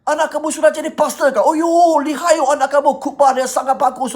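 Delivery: high, loud impressed neighbour; hammed-up amazement, pitched well above normal; gushing praise. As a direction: imitate excited coffee-shop flattery. Acting townsfolk praising the pastor's father.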